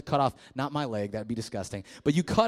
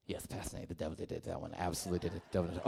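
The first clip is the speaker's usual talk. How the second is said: put-on low, much softer girl's voice; imitating teen girl; 10 semitones lower, 14 dB quieter, much faster than usual. Pastor imitating a girl blaming the devil, softly. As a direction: hush it like an embarrassed teen.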